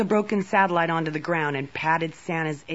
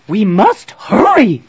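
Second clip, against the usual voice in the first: loud, high, frantic urging; urgent cry; 4 semitones higher, 11 dB louder than usual. A narrator's reading. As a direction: hurry them along at full voice. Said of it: "cried the Moon Queen, waving her arms".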